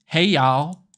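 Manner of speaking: loud, drawn-out American Southern twang; exaggerated US Southern accent; mock Southern accent. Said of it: "Southern people, like".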